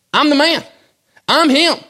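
loud, high triumphant declaration; bold proclamation. Retold or imitated. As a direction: announce it like a champion. Jesus declaring himself to crowds.

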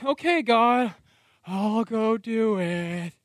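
slow, grudging mock compliance; reluctant agreement. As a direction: say yes to God while pushing back. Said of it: "I'm pushing back".

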